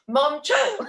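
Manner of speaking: high, loud, through real laughter; laughing. Real, not acted. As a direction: crack up while saying it. Laughs while addressing her mom.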